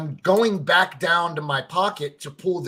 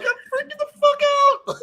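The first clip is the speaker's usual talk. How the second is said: much higher, squeaky mock panic; mock freak-out; 6 dB louder than usual. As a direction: squeal it like you're losing it. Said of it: "and I'm like".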